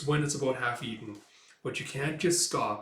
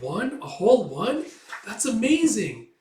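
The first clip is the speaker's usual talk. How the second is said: higher and louder with each exclamation; amazed; 8 semitones higher, 8 dB louder than usual. Acted. Girl astonished a friend eats a whole melon, excited. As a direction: build from surprise to delighted cry.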